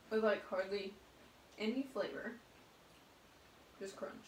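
talking between bites; chewing while talking. Real, not acted. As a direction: mouth partly full. Seen 0: Spoonful taken at -2; lips working, cup and spoon held throughout.

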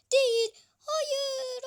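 acıyla bağıran hayır; kaçan gole isyan edip bağır; feryat. Real, not acted. Gol kaçınca feryat. (kaçan gole isyanla bağırış)

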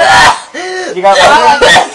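giggling through the question; laughing. Real, not acted. Laughing at bean flavor.

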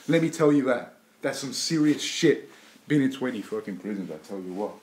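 ordinary talk

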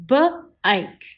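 B-ike split into onset and long vowel; segmented. Shown as a demonstration. Teacher sounding out B-ike for the class. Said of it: "Say it out loud with me".

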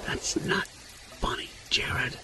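slow, breathless whisper, barely voiced; scared whisper. Frightened friend whispering to Jared by the campfire. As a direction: hiss it so nothing in the trees hears.

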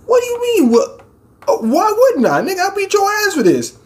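high-pitched mock outrage; high, hammed-up indignant rant; indignant protest. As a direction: squeal a comic threat in indignation. Acted indignant retort to the offer.

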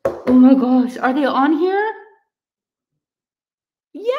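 excited. Real, not acted. Checking the milestone on her phone.

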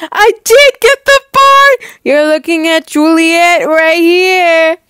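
very high, loud joyful outburst; triumphant squeal. Acted. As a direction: burst out with the big news. Reveals she got the part.

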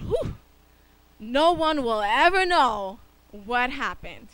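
a loud, slow sigh of relief; whew, got away with it; mock relief, drawn out; relieved sigh of thought. Child's relieved thought retold.

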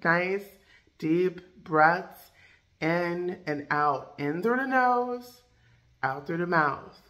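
slow, soft breathing-guide voice; guided breathing. Acted. Coach guides viewers through breathing, slow and soft.

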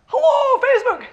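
high, loud live-stream hello; excited shouted greeting. Real, not acted. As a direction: shout hello to an online audience. Opens live stream after countdown.

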